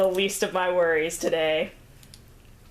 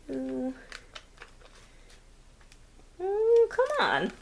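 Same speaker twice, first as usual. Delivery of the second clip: exasperated; 9 semitones higher, slower than usual. Real, not acted. Crafter frustrated at wiggly object.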